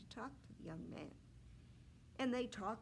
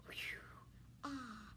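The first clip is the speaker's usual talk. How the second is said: plain reading voice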